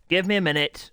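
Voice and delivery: exasperated streamer snapping at chat; snappy and irritated. Real, not acted. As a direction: snap it out, loud and high, fed up. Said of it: "Sorry for my temper and attitude there".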